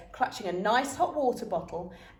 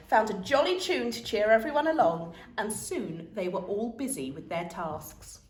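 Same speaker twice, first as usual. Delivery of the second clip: ordinary delivery, nothing marked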